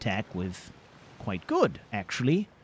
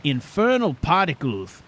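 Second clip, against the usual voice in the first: losing his temper; 3 semitones higher, 6 dB louder than usual. Real, not acted. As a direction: let the frustration boil over. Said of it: "I've compromised my composure".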